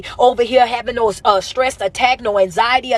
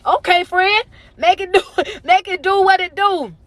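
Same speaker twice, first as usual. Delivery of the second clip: high-pitched playful cheer; playful hype; 8 semitones higher than usual. Real, not acted. Host cheers a friend raising the likes goal, high and playful.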